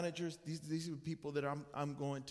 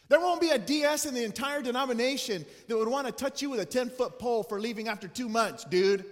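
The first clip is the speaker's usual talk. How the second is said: loud, mocking devil voice, higher; devil taunting; 4 semitones higher, 8 dB louder than usual. Pastor voicing the devil mocking his failure, loud taunting sneer. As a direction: jeer at him like the devil in his ear. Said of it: "The devil began to kinda just whisper in my ear".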